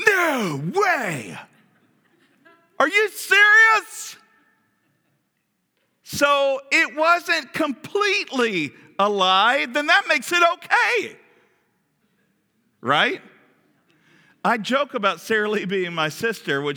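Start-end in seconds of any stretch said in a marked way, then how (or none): 0.1-4.1 high, loud, slow mock outrage; hammed-up shock, up high and loud; mock disbelief. Mock outrage at Abraham's excuse. 6.0-13.2 high, slow mocking sarcasm; hammed-up sarcastic reasoning. Sarcastic mock of Abraham's logic.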